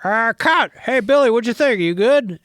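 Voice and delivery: high, loud puppet-like director's call; Frank Oz. Retold or imitated. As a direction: call cut across the set in a puppety voice. Frank Oz calling cut on set.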